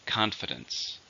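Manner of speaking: CON-fidence, first syllable strong; "Confidence" said with the stress on CON. Shown as a demonstration. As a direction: model "Confidence" with weight on CON. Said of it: "Stress is on the first syllable".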